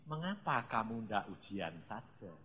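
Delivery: low, soft, easygoing question; relaxed voice of God. Retold or imitated. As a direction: gently suggest it, like an unbothered father. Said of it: "malah ngomongnya enak. Tuhan tuh ya santai". (suara Tuhan yang santai, rendah dan lembut)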